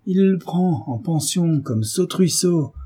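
slow overbearing pronouncement; brutish husband. A narrator's reading. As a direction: spell it out slowly as a domineering husband. Brutish husband dictates son's fate.

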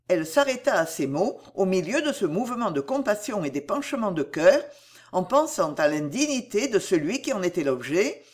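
ordinary delivery

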